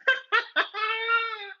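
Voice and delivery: very high gleeful ghostly cackle; shrieking mocking cackle; poltergeist cackle. A narrator's reading. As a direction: laugh like a spiteful little spirit. Peeves gloating.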